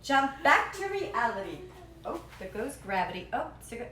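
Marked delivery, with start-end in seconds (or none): none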